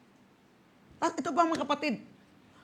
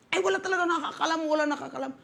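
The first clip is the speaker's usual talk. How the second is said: rushed, slightly higher brush-off; lightly voiced quick protest; dismissive objector; 5 semitones higher, much faster than usual. Stranger answering whether he is saved, hurried dismissive reply.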